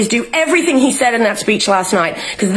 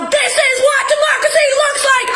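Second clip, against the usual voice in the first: street protest yell; 14 semitones higher than usual. Retold or imitated. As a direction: yell it out like she's marching in the streets. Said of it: "go in the streets and yell".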